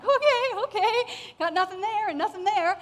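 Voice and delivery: high, loud "okay, okay"; comply loudly with the search, pitch jumping up; flustered compliance. Retold or imitated. Reacting to being wanded over.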